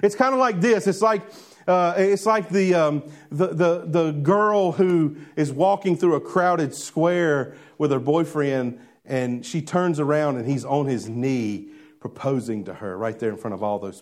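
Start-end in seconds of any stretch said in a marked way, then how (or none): none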